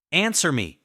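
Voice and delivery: loud furious command; husband shouting at lover; shouted demand. A narrator's reading. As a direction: bark it at him.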